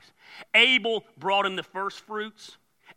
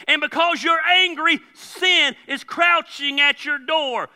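louder, higher rebuke as God to Cain; fiery preaching; 8 semitones higher, 6 dB louder than usual. Acted. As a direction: thunder it at Cain like a stern God. Preaching God's rebuke at full force.

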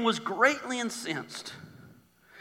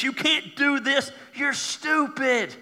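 high-pitched scornful taunting; mocking, incredulous put-down; ridiculing; 5 semitones higher, slower than usual. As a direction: belittle them, dragging out the final words. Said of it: "they're ridiculing this".

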